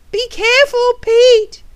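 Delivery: high, loud warning call; urgent warning. Acted. Warning Pete about the net, urgent call. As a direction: call out high to stop them.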